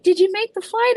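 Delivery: a light imitation of the worried cousins; cousins asking. Cousins texting about the flight, lightly imitated high voice. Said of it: "My cousins are like".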